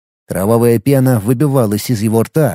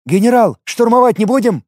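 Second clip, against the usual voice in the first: higher soldier voice asking; 9 semitones higher than usual. A narrator's reading. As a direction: ask the general with a raised pitch. Subordinate asks general.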